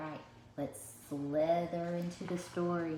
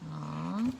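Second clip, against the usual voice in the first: ordinary delivery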